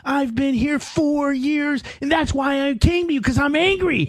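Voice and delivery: high, slow mock outrage; imitating yelling at his therapist; retold angry rant. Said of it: "anger issues".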